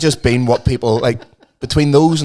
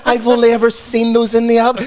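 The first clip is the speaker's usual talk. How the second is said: high, stretched-out swooning over a gift; hammed-up awe, dragging the words out high; mock-adoring mum; 8 semitones higher, 3 dB louder than usual. Ma gushing over six quid chocolates, mock adoring. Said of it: "It's the way she looked up at him and goes".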